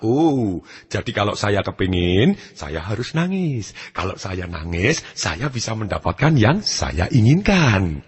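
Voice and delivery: put-on drawn-out pondering as the child; child's dawning realization. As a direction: work it out slowly, word by word. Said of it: "dia menghubungkan antara satu perasaan".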